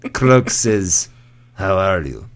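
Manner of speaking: put-on Russian-accented joke with long pauses; old Russian lady. Old Russian comic delivering her punchline, Russian accent. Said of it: "this old Russian lady".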